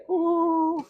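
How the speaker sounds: a sudden high ooh of surprise; startled falsetto ooh; surprised ooh. Real, not acted. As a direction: yelp up high as you catch the mistake. Catching own mistake.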